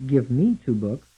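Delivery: "me" carries the main beat; stress on ME. Shown as a demonstration. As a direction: lean on "me", keep "Give" lighter. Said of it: "stressed on the second syllable".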